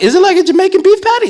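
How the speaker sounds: squeaky high, loud excited question; hammed-up wide-eyed curiosity; mock naive. Pastor asking friends about shepherd's pie, high and excited.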